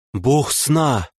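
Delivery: astonished exclamation. Acted. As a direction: gasp it out in amazement. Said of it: "поражённо воскликнула Лея".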